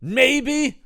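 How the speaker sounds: high, loud mocking cry; mock shout. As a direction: yell it in exasperation. Mock answer in retold scene.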